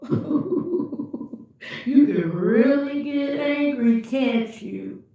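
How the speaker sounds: taunting, laughing high; mocking laugh. Narcissist mocking her anger, laughing as he taunts. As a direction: tease her through a laugh. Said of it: "He began to laugh".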